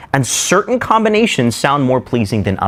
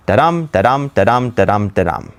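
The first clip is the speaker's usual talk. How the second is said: five da-dum beats run together, stepping down; iambic pentameter rhythm; 2 semitones lower than usual. Shown as a demonstration. Teacher demonstrating iambic pentameter, chanted da-DUM beat. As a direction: chant "da-dum" weak-strong, falling to the end. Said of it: "iambic pentameter".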